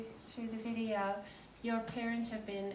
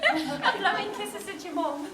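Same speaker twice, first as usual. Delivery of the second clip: laughing; 9 semitones higher, 8 dB louder than usual. Real, not acted. Giggling at start of live.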